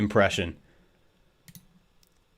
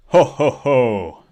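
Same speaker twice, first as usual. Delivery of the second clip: slow, louder drawn-out Santa laugh; 2 semitones higher, 6 dB louder, much slower than usual. Acted. Testing the recorded Santa laugh, slow and drawn out. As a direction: stretch out each ho like Santa. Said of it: "get my Santa voice on".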